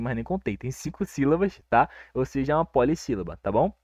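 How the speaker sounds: ordinary delivery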